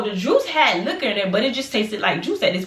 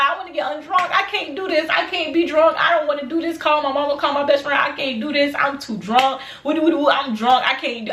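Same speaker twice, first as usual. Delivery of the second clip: high-pitched mock wailing; hammed-up tearful pleading, pitched up; retold crying; 7 semitones higher than usual. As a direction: whine it like a drunk crying meltdown. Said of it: "I was crying".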